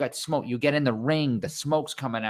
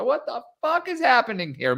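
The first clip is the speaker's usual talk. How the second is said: shocked; 10 semitones higher than usual. Retold or imitated. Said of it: "I'm like".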